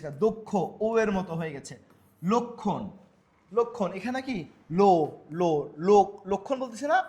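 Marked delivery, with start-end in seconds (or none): 4.7-6.3 "লো" loud with a rounded o; o-sound demo. Shown as a demonstration. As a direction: sound out "লো, লো, লোক" in separate, forceful pieces.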